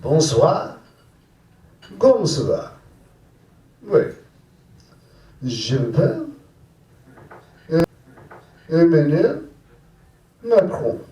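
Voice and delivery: mock French phrases; hammed-up French; French set. Comic doing his French set, mock French. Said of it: "my French set".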